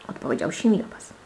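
usual speaking voice

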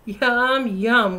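a light horsey whinny on each yum. Acted. Horse whinnying yum yum about chocolate. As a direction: neigh the words a little. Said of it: "whinnied Horse".

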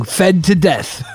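ordinary talk, nothing marked

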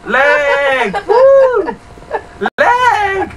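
high, loud whooping yell; excited yell. Real, not acted. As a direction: cheer it out at the top. Shouting about lag.

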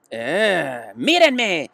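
put-on boy yelling for attention; Avery shouts. A narrator's reading. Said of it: "gritó Avery".

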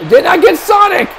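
high-pitched, excited call; excited play-by-play. Real, not acted. Commentator seeing an attack miss Sonic, excited call. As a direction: jump up in pitch at the near miss. Calling a missed move.

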